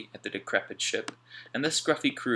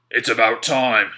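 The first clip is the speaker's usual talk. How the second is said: much louder, slow gruff scolding; Slank, harsh boss; 13 dB louder than usual. A narrator's reading. Slank scolding Mac and Alf, slow loud scolding. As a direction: scold the late men.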